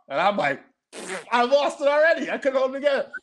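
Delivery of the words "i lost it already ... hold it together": high, loud voice on the edge of laughter; high-pitched, barely holding together; cracking up. Real, not acted. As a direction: tell it while losing it. Reliving losing it with laughter.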